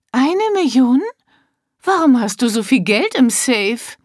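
high, rising "Eine Million?" in disbelief; incredulous echo. Acted. Wife echoing her husband's loss, incredulous. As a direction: throw "Eine Million?" back at him, aghast.

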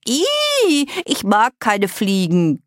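very high "Ih", then nasal disgust; grossed-out little girl; revolted squeal. A narrator's reading. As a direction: squeal and shudder in revulsion. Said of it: "Greta schüttelte sich".

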